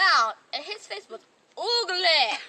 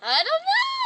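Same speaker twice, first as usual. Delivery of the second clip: higher, louder mock shout of the guy; mock yell; 12 semitones higher, 4 dB louder than usual. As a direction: yell it back like a stubborn boy. Voicing the guy again.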